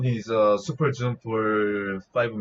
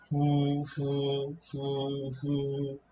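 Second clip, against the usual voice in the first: soft, drawn "후" hoots fading away; English owl hoot; 8 dB quieter than usual. Shown as a demonstration. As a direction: imitate an English-style owl with airy "후". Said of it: "In English, you guys pronounce".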